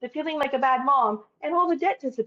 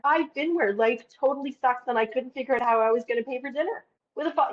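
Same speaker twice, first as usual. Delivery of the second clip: ordinary talk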